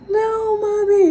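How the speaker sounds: high, loud cry of a frightened son; scared child. Retold or imitated. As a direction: imitate a scared kid crying out to mom. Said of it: "He got really scared".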